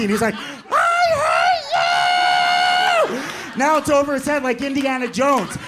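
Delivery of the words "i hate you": very high, long drawn-out shriek; mock enraged scream. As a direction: stretch every word into a hammed-up scream. Mark going berserk.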